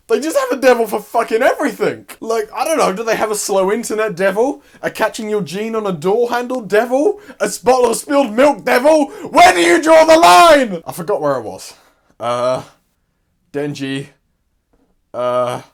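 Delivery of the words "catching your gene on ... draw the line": high, loud exasperated shout; exasperated rant. Real, not acted. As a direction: build the rant to a big shouted climax. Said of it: "ranting about devils".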